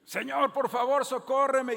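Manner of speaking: loud, high begging shout; desperate cry for help. Retold or imitated. A desperate mother begging the passing Lord, loud and high. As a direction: cry out for mercy at full voice. Woman crying out again.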